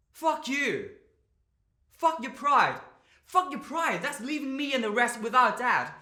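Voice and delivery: loud, high-pitched raging; anguished yelling, punching each phrase; furious, grieving outburst. Acted. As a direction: shout your hurt at someone leaving you. Rages at his dying father.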